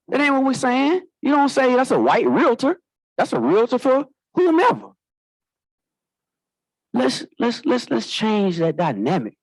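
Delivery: high, rising fervor; passionate. Real, not acted. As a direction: urge the room, fired up. Passionate plea at a luncheon.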